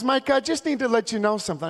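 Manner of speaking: high, quick mock-polite voice; passive-aggressive nice. Minnesota mom correcting a dad, passive-aggressive nice. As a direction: imitate a sugary-polite busybody mom. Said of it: "super passive aggressive".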